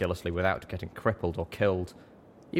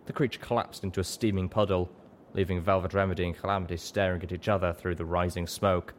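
ordinary delivery, nothing marked

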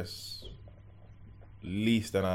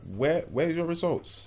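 slow, higher-pitched demand, imitating mum; imitating stern mum; 7 semitones higher, slower than usual. Mum confronting son over exam results, pointed questioning. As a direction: voice a mother pointedly asking. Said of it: "my mum come in and she was like".

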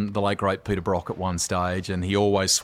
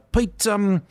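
high-pitched put-on New Zealand accent; prank-call as a chirpy Kiwi radio host; hammed-up, high Kiwi presenter voice; Kiwi radio announcer; 14 semitones higher, 4 dB louder than usual. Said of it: "this Kiwi radio announcer accent".

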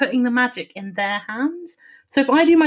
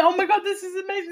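quick, high, breathless gush of wonder; gushing amazement; 8 semitones higher, 4 dB quieter, much faster than usual. Retold or imitated. Retelling her reaction to the polo trick, giddy and fast. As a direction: gasp it out like you can't believe your eyes.

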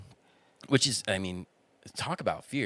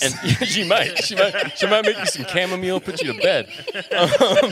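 laughing through the words, higher and louder; cracking up while talking; laughing hard, words pitched up; 8 semitones higher, 9 dB louder than usual. Real, not acted. Laughing at friend's joke.

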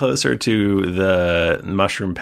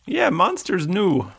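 high-pitched creature voice; Blurg Blurg; 12 semitones higher than usual. Acted. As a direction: answer pitched well up, in character. Game master answers as Blurg Blurg.

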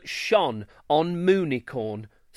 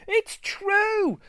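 very high excited cry; cried out. A narrator's reading. Unicorn in the crowd crying out in shock. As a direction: call it out from the crowd. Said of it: "someone cried from the crowd".

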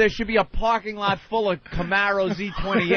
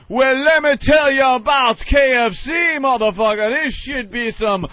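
loud, high hammed-up ad shout; mock TV pitchman; 7 semitones higher, 6 dB louder than usual. Mocking a TV ad spot, loud and high. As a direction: shout it like a cheesy commercial.

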